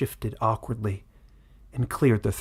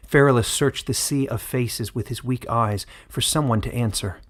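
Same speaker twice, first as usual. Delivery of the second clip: usual speaking voice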